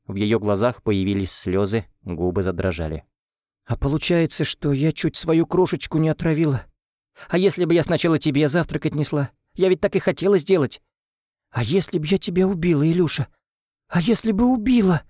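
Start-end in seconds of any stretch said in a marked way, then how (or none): 3.7-15.0 tearful. Acted. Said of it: "всхлипнула Ангелина, обливаясь слезами".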